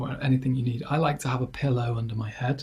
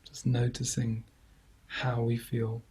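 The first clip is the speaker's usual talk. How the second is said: guided meditation; 5 dB quieter than usual. Acted. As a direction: ease listeners gently into calm, leaving long silences between phrases. Leads a body scan.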